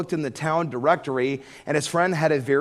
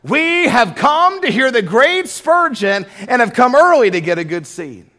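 pious announcement; 9 semitones higher, 10 dB louder than usual. Retold or imitated. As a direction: puff it up like a self-important churchgoer. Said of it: "they so piously announced".

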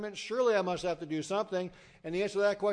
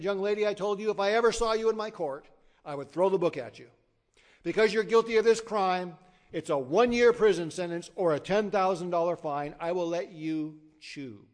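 lightly voiced stern judge, louder at first; 3 semitones higher, 7 dB louder than usual. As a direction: hand down the sentence firmly, a hint of a stern judge. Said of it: "looked at her sternly and said".

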